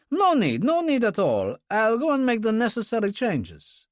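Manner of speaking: high-pitched, flustered reassurance; hasty. A narrator's reading. The aide promises Kurbsky new seating, hasty. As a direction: hurry to smooth it over. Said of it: "the aide said hastily".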